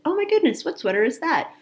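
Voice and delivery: high surprised exclamation. Retold or imitated. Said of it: "Charlene, when I walked in, said".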